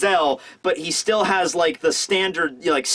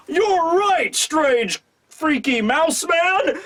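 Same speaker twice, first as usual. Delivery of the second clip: much higher, drawn-out cry on freaky mouse man; resounding agreement; 10 semitones higher, much slower than usual. Retold or imitated. Urashima agreeing with Mouse Man, high and slow. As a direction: bellow your agreement, stretching the last words slowly. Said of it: "a resounding".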